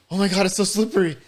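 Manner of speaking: high, quick comic voice; overwhelmed Dale imitation. Dale in the men's bathroom, mock-overwhelmed. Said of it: "And he's like".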